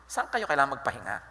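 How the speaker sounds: ordinary talk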